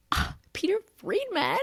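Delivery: high-pitched mock fangirl squeal; gasp, then squeak the name in awe; starstruck. Fan overwhelmed at meeting Peter Friedman, starstruck. Retold starstruck exclamation at the actor.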